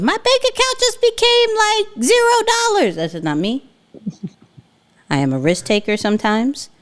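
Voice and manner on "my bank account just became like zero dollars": high, whiny complaint; whiny panicked complaint; mock panicked client. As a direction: squeal it like an upset client blaming you. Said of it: "do not hit me with".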